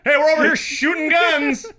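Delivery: loud high shouted call; mock call out. Friend voicing neighbors calling out, loud mock holler. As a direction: holler it across the campsite. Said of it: "They're like".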